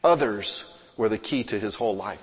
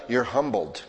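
ordinary talk

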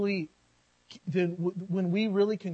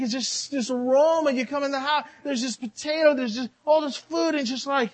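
loud, high fervent preaching; full-force revival preacher; 10 semitones higher, 9 dB louder than usual. Acted. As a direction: preach it with fire.

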